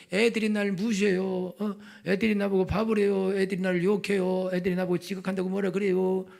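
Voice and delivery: high sing-song whining; whiny mock complaint. Pupil complaining that classmates pick on them, mock whining. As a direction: mimic a sniveller listing grievances. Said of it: "울고 불고 날 무시했어요".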